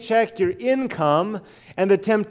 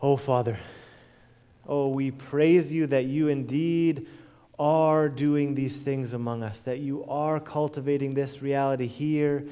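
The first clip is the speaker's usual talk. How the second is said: softer, lower voice of prayer; 6 semitones lower, 5 dB quieter than usual. Real, not acted. Preacher leading the congregation, a soft, reverent prayer. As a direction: turn from the people and speak to God.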